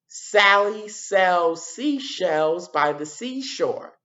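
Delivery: very slow, careful tongue twister; slow demo. Shown as a demonstration. Teacher modelling the tongue twister slowly for the class. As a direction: spell out each s word slowly. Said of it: "what sound do you hear again and again".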